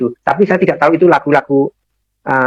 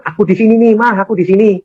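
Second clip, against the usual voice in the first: raised, higher re-enacted call to wife; retold calling, pitched up; 8 semitones higher than usual. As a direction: call back to reassure her you're here.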